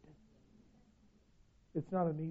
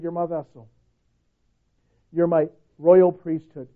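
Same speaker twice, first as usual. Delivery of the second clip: ordinary delivery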